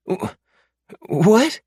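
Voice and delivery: high, panicked pleading voice, fast and loud; terrified captive man. Acted. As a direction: beg for your life in a frantic high voice. Mark stunned by demand.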